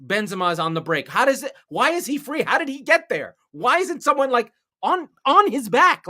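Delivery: high, loud, baffled shouting; imitating a panicked fan at the screen; exasperated fan yell. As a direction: yell it like a fan watching the defence collapse. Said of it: "You're like".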